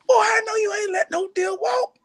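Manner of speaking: very high, drawn-out mock outrage; slow, high-pitched scolding; mad granddad. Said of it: "my granddad gets so mad".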